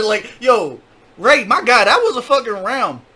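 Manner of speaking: loud, high hype for the round; big excited shout-out to Ray; loud and high, fired up; hyped up. Real, not acted.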